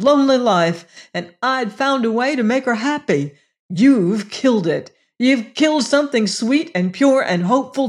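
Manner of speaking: pained, impassioned outburst; despairing grief. A narrator's reading. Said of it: "cried Venters in despairing grief".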